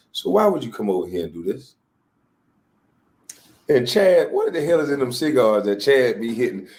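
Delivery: normal talking voice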